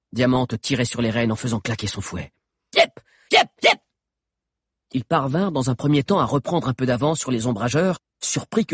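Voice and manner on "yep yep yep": short, loud yelps; driving calls. Acted. Diamante cracking the whip over Grove, driving calls. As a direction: urge the horse faster with each cry. Driver urging on the horse.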